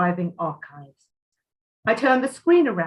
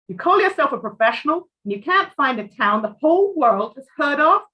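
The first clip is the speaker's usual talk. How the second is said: louder, scornful rebuke; a sharp, louder put-down from an irritated customer; indignant client; 6 semitones higher, 5 dB louder than usual. Acted. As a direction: scold him for incompetence. Client scolds the researcher.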